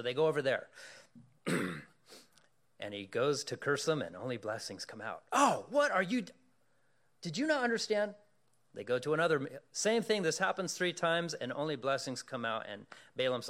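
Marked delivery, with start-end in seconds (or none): 5.3-8.1 high, loud scolding king voice; exasperated king. Retold or imitated. King berating Balaam again, high and loud. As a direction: snap at the failing prophet.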